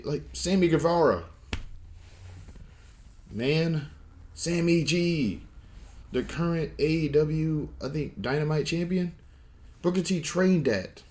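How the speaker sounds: high, hyped-up praise; excited. Real, not acted. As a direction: rave about him, voice jumping up. Hyping up Sammy Guevara's success.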